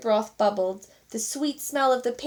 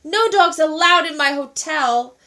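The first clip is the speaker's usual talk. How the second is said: loud, stress on No; angry declaration; 5 semitones higher, 8 dB louder than usual. A narrator's reading. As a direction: lay down the rule furiously. Manager furious about dog.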